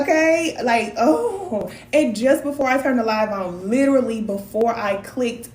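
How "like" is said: normal talking voice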